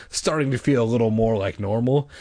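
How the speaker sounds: ordinary delivery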